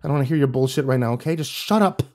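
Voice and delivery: ordinary delivery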